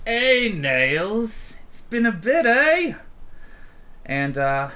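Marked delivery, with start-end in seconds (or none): 0.1-2.9 much higher, louder cocky hail; Marcus, cocky. Acted. Ganger leader hails the bar owner, cocky and loud. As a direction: greet her like an old pal, swaggering. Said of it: "he's like".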